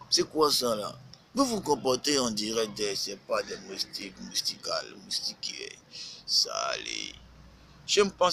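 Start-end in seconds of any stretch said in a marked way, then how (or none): none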